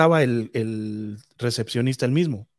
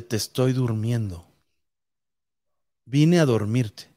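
eerie ghost voice; 4 semitones lower, slower than usual. Retold or imitated. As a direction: let the ghost speak slow and low. Ghost answers the man.

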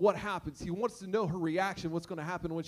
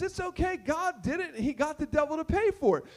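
cheerful reply; 6 semitones higher than usual. Retold or imitated. Woman answers neighbor calmly.

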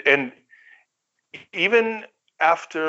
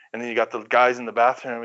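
usual speaking voice

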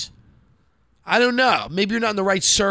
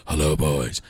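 deep sultry voice; slower than usual.

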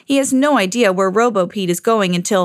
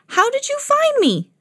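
much higher, astonished question; surprised. Acted. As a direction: squeal it out in amazement. Pete amazed at being found.

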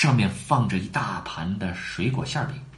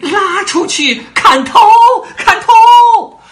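shrieking, much higher and louder, drawn out; screaming order; 12 dB louder, much slower than usual. A narrator's reading. Furious Queen ordering Alice beheaded, shrieking. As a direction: scream the sentence at the top of her lungs. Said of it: "王后立刻尖叫了起来".

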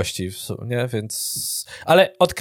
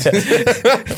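a short, high burst of laughter; real laugh; 9 semitones higher than usual. Real, not acted. Laughing at the smelly mall.

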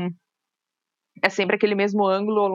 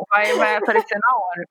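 ordinary talk, nothing marked

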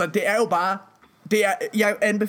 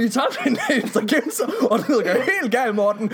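high, laughing mock panic call; imitating his friend calling in a mock panic; mock panicked soldier; 5 semitones higher than usual. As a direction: ham up the frantic phone call. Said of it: "da du ringede i går og sagde".

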